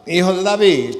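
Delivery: loud, high insistent shout. Acted. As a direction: shout it out, refusing to be silenced. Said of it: "Clama mucho más".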